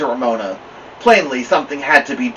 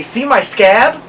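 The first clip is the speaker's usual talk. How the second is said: proud; 9 semitones higher, 5 dB louder, slower than usual. A narrator's reading. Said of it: "she said proudly".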